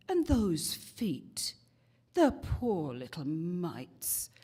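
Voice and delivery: a hint of a Cockney gossip; lightly voiced English lady tutting; second Cockney woman. Other woman on the bus agreeing, lightly mock Cockney. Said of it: "The other woman says".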